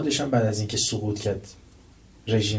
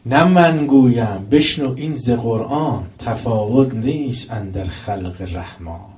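slow, loud declaimed verse; loud, slow verse recitation from the pulpit; poem recitation; 6 dB louder, slower than usual. Acted. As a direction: declaim each half-line slowly to the crowd.

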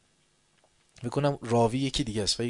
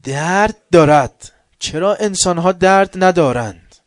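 preaching outcry; 6 semitones higher, 14 dB louder, slower than usual. Real, not acted.

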